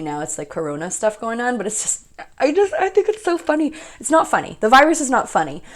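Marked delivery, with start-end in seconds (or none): 2.4-3.7 laughing. Real, not acted. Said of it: "I'm laughing because".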